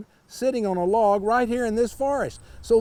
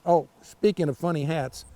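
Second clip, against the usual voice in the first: plain reading voice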